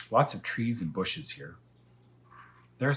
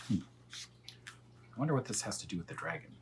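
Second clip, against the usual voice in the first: ordinary delivery, nothing marked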